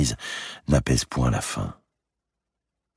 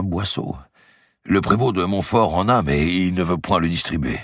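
a bit higher, weary and glum; lightly voiced gloomy clerk; 6 semitones higher than usual. A narrator's reading. Head clerk lamenting the famine to Guccio, glum. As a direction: complain in a tired way.

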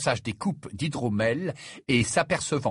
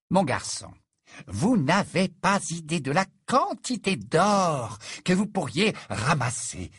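lightly voiced salesman, drawn out and raised; tempting biographer; 6 semitones higher than usual. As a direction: dangle riches, stretching the words out. Narrator voices Worple tempting Harry.

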